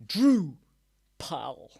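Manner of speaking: breathy stage whisper of "Drupal"; mock-mysterious whispered "Drupal". Shown as a demonstration. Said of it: "I know, Drupal".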